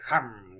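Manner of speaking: low humming engine revs; revving engine noise. Pretend. Teacher readying the class for a peek, low engine hums. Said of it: "rev your engines".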